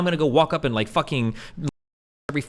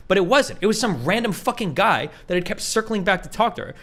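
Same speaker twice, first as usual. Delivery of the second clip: normal talking voice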